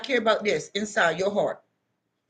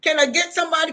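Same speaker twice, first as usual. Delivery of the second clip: pleading cry to Christ; 8 semitones higher than usual. Retold or imitated. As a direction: cry it out to God. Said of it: "I said".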